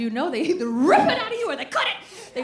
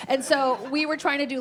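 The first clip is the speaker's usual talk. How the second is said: usual speaking voice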